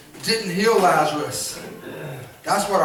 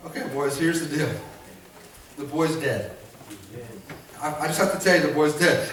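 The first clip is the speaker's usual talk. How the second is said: lower, plain-spoken Jesus breaking the news; calm Jesus; 8 semitones lower, 6 dB quieter, faster than usual. Retold or imitated. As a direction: tell the boys straight, low and steady. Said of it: "And Jesus is like".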